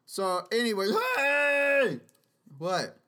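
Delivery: ordinary talk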